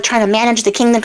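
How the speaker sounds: ordinary delivery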